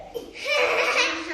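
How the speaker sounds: high burst of laughter; real laugh. Real, not acted. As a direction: laugh at the story. Laughing at the silly story.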